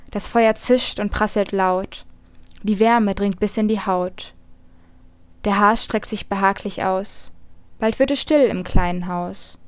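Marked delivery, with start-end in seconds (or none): none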